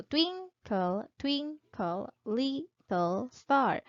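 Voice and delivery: slow "twinkle, twinkle, little star" in separated syllables, TWIN, TWIN, LIT and STAR strong; deliberate "TWIN-kle, TWIN-kle, LIT-tle STAR"; stressed-unstressed pattern. Shown as a demonstration. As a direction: chop "twinkle, twinkle, little star" into alternating strong and weak beats. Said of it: "there is a pattern of sounds".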